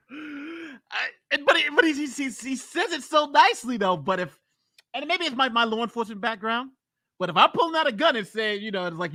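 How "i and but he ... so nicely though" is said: amused. Real, not acted. Still amused after long laugh.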